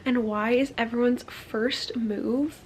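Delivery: usual speaking voice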